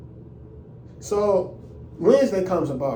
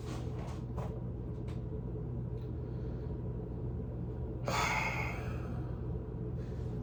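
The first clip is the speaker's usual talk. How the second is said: long sigh; 16 dB quieter than usual. Real, not acted. Exasperated pause after the scolding.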